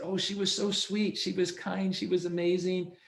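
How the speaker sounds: high, gushing and sweet; raving fondly about the old agent; gushing client. Retold or imitated. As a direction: coo it like a fond customer.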